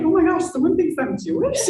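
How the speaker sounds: high, loud delighted squeal; delighted disbelief. Retold or imitated. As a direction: gasp it out, thrilled. Retold delighted reaction.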